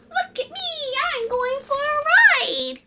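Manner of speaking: very high, gleeful spider voice; thrilled little spider. Acted. Spider riding on the family car, thrilled showing off. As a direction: show off with squealing glee.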